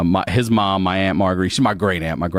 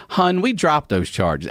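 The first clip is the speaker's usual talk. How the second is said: Baltimore aunt; 6 semitones higher than usual. Retold or imitated. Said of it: "Everyone calls people hun in Baltimore".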